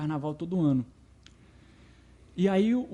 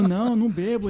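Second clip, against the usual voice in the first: louder, laughing no; laughing denial; 4 semitones higher, 7 dB louder than usual. Retold or imitated. Answers, laughing.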